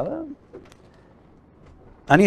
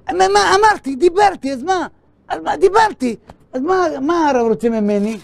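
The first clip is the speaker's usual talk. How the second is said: high-pitched, defensive protest; indignant protest; 13 semitones higher, 3 dB louder than usual. Acted. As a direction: act out an indignant man pushing back. Contractor protests being held to word.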